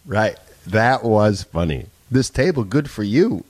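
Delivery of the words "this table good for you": lightly imitating Walken's halting cadence; a hint of Christopher Walken; Christopher Walken impression. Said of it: "I like the Christopher Walken one".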